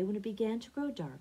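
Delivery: ordinary delivery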